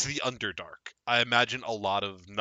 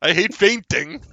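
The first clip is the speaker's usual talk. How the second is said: louder, higher mock whine; mock complaint; 9 semitones higher, 11 dB louder than usual. GM mocks the fainting weakness, loud and whiny. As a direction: whine it as a joke.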